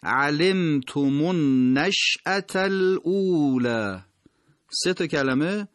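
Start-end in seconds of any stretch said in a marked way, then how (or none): 0.0-4.0 علمتم النشأة الاولی slow, the three words joined smoothly; slow connected recitation; linked recitation. Shown as a demonstration. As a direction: recite the three words as one flowing phrase. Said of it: "باید به هم دیگه وصل بشن".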